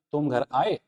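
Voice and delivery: "आए" lifted into a question; question intonation. Shown as a demonstration. Teacher repeats the example for students, questioning rise on "आए". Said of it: "question mark के रूप में".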